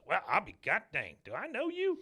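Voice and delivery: put-on folksy old man jumping up high on the question; a stunned old coot squinting at her. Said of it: "He goes".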